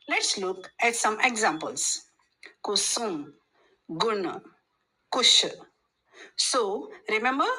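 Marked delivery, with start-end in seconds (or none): 2.6-5.5 quick short oo in "Kusum, gun, kush"; short u vowel. Shown as a demonstration. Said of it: "gives a short u sound".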